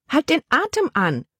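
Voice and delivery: high, urgent call without real volume. A narrator's reading. Aunt instructs her hiccuping niece, calling out. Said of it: "rief ihre Tante".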